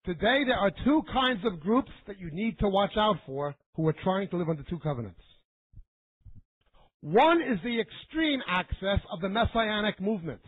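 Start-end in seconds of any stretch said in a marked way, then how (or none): none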